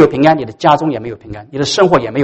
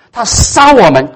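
slow, higher and louder outrage; indignant accusation; 8 semitones higher, 4 dB louder, slower than usual. Retold or imitated. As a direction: spit out each crime. Jonah listing Nineveh's crimes.

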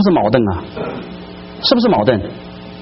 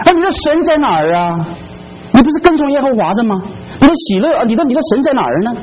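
higher, sneering jeer at a believer; mocking taunt; 7 semitones higher than usual. Mockers taunting the suffering psalmist, sneering high. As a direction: taunt him like a scornful mocker. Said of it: "他嘲讽他什么".

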